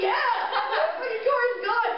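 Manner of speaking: high, gleeful cheer through laughter; excited dad. Retold or imitated. Dad celebrating the stolen car, high gleeful cheer. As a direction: cheer like a dad set free. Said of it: "He was so excited".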